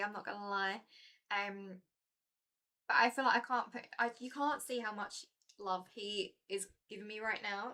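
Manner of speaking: ordinary delivery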